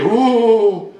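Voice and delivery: hugely drawn-out rounded "oh"; old oratorical vowel. Shown as a demonstration. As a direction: parody an old orator booming "oh". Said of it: "insistait beaucoup sur les voyelles".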